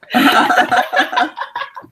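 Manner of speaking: high, loud laughing burst; helpless laughter. Real, not acted. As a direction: keep cracking up, out of control. Player still cracking up.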